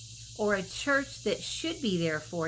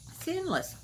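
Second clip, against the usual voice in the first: "endless" repeated higher and softer, falling; higher, softer echo; 8 semitones higher, 3 dB quieter than usual. Shown as a demonstration. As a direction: let "endless" rise up high then fall softly. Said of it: "repeats the phrase".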